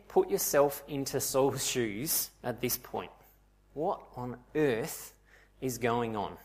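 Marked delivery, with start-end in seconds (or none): 3.7-6.4 slow, stunned question with a break mid-way; acted bewilderment. Saul hearing the voice after the blinding light, stunned. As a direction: let it out slowly, at a loss. Saul's stunned inner reaction.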